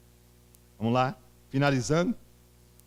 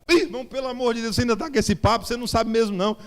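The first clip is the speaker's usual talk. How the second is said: higher, fast, dismissive teasing; exasperated mockery; 8 semitones higher, faster than usual. Pastor at three a.m. dismissing the convert, exasperated. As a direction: brush him off with a mocking laugh in the voice.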